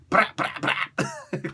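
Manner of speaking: slow, whispered brah brah brah; breathy sign-off chant. Acted. Host signing off his show, slow breathy chant. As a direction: drag out a signature outro sound.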